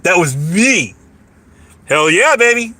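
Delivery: usual speaking voice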